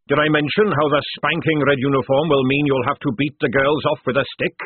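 loud, booming fat sergeant; hearty sergeant. Acted. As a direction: boom it like a jovial recruiter. Sergeant pitch.

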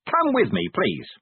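high-pitched, effusive welcome; over-hearty, high exclamations; shouted false enthusiasm. A narrator's reading. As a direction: gush grandly, pitched well up. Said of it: "he shouted".

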